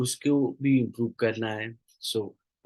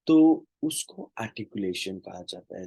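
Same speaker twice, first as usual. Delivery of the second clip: ordinary talk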